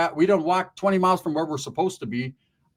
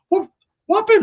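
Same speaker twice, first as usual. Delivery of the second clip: high, loud whoop; imitated whooping; 8 dB louder than usual. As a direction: whoop like a kid catching water.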